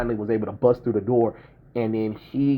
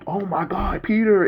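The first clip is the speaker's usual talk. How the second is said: high, thrilled cry of the name; excited Deadpools; 10 semitones higher than usual. Retold or imitated. Deadpools thrilled to see Peter, high excited cry. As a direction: squeal the name like starstruck fans. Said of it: "they were like".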